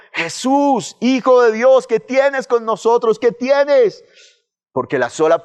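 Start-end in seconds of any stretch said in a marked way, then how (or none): 0.1-4.0 desperate cry. Retold or imitated. Demons crying out in terror before Jesus, high anguished wail. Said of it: "ahora estaban clamando de miedo".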